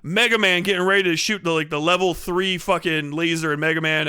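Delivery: usual speaking voice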